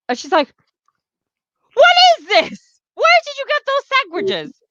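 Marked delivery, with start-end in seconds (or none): 1.7-4.6 very high, indignant screech; hammed-up shrill demand for answers; acted outraged shriek. Retelling a confrontation over stolen sandwiches, acted outraged shriek.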